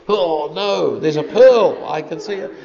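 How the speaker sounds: mock alarm. Mocking a legalistic pearl-spotter.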